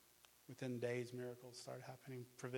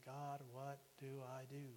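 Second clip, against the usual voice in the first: tearful plea; 6 dB quieter, slower than usual. Retold or imitated. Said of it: "crying and crying to God".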